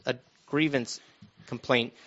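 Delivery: ordinary delivery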